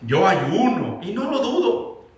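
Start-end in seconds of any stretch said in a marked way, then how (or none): 0.0-1.0 lower, slow, holier-than-thou; mock pious voice. Preacher mocks a believer acting spiritual, lower and slow. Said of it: "se hace muy espiritual".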